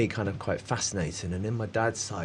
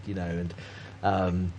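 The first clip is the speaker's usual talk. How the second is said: usual speaking voice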